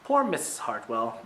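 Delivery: plain reading voice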